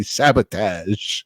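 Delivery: slow, drawn-out, lightly voiced dramatic "sabotage"; William Shatner. Doing a slow, lightly voiced Shatner "sabotage" for his hockey podcast co-host. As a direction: stretch "sabotage" out slowly like a hammy starship captain. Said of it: "as William Shatner would say".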